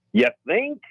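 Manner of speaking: put-on high, sharp sarcastic New York accent jab. Pilot imitates the sassy New York controller in a New York accent. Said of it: "that real New York accent".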